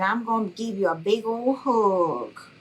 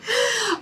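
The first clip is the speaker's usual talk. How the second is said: high laugh. Real, not acted. Laughing at viewer comment.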